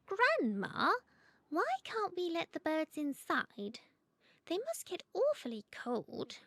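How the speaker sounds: put-on high child voice asking grandma; a small girl, worried about the birds; little girl asking. A narrator's reading. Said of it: "Harriet asked".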